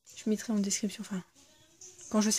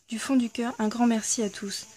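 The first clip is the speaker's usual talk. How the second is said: lecture de la carte; 4 dB louder than usual. Acted. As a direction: lecture à voix haute, un peu plus fort. Reads the founders' card aloud. (lecture à voix haute d'une carte de remerciement)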